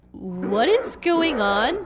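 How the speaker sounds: slow, lightly voiced bewilderment; bewildered sibling. Sibling reacts to the villain, slowly bewildered. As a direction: draw it out low, puzzled. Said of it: "one of my siblings is like".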